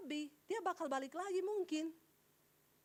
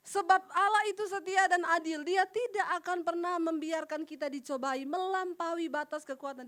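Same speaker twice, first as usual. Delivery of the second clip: louder, hammering each phrase; emphatic reread verse; 7 dB louder than usual. Shown as a demonstration. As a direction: proclaim it so it sinks in. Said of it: "tolong direnungkan, jangan cuma dibaca".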